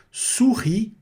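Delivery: high "Souris" ending on ee, no s; silent S. Shown as a demonstration. Teacher modelling for students, "Souris" with silent S. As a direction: finish "Souris" on the vowel, su-ree. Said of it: "não pronuncia o S".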